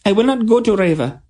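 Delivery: high, fast, loud refusal; defiant announcement. A narrator's reading. Tenedra refusing the treaty, loud and defiant. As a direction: plant your feet and refuse. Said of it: "Tenedra announced".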